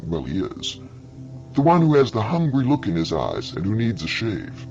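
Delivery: plain reading voice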